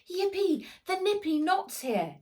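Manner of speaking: high, loud joyful yelp; excited cry. Acted. Max spots Grandpa's ship, excited high cry. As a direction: squeal it out in delight. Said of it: "he cried".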